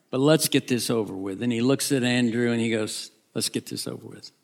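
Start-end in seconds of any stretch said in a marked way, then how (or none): 3.3-4.3 softly said, with resignation; quiet, grudging go-ahead; resigned aside. Acted. Peter resignedly telling Andrew.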